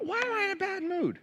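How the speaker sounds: high, bewildered inner voice; asking yourself, baffled; puzzled self. Retold or imitated. Imagining a listener puzzled over his own mood, high and bewildered. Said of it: "You're like".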